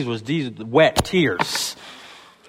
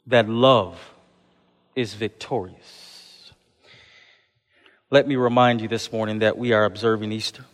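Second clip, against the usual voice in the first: ordinary delivery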